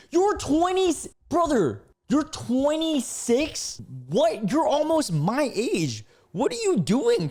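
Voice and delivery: high, loud disbelief; flabbergasted, pitch way up; incredulous outburst. Real, not acted. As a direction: exclaim in shock, stretching the key words. Shocked at the texter's stated age.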